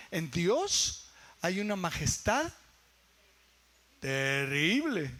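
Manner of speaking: slow reading, drawing out "terrible"; weighty quote. Real, not acted. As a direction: linger on "terrible" with a big pitch swoop. Dwells on surprising Bible word.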